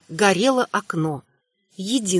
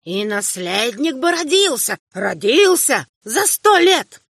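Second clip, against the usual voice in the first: put-on high, slow ranting old man's voice; bitter old emperor; 4 semitones higher than usual. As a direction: accuse the son like a failing old king. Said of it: "закричал старый император".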